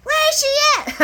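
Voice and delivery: Lucy storming in, shouting high; furious Lucy. Retold or imitated. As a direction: storm in demanding answers. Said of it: "here comes Lucy like a hurricane".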